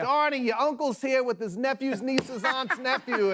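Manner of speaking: high, slow mock holler; calling out. Local calling to Donnie mid-take, hollered imitation.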